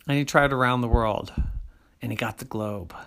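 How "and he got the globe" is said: plain reading voice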